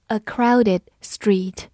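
A crowded street said slowly and clearly; slow model reading. Shown as a demonstration. Teacher slowly modelling A crowded street. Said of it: "比如说".

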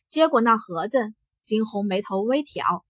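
plain reading voice

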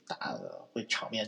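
slow half-whispered aside. Real, not acted. (压低声音慢慢说)

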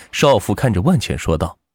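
plain reading voice

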